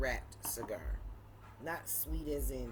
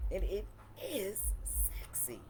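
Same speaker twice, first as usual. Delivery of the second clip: whispered; 5 semitones higher than usual. Real, not acted. Admiring the bottle.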